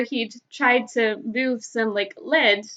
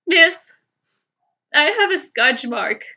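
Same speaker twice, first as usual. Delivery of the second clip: high, loud imitation of a crying boy; crying little boy; 4 semitones higher, 6 dB louder, slower than usual. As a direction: play the upset five-year-old, voice wobbling. Crying boy voiced in story.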